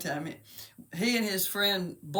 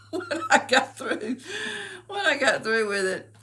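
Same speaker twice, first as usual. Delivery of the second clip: laughing; 8 semitones higher than usual. Real, not acted. Mother telling of her son's reaction, laughing. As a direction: laugh while trying to go on.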